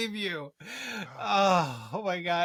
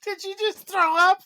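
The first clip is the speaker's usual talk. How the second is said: laughing shout; 12 semitones higher, 5 dB louder than usual. Real, not acted. Host laughs as guest gags.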